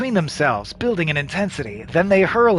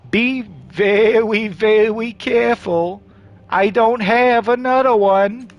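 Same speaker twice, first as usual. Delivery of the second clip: slow, higher lisping cartoon hunter; nervous trinket seller doing Elmer Fudd; 4 semitones higher, 3 dB louder, slower than usual. Nervous seller handing over trinket, slow Elmer Fudd voice. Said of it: "I don't know why he became Elmer Fudd".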